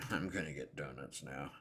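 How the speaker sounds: quietly laughing through it. Retold or imitated. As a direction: chuckle while repeating it. Echoes the announcement, laughing.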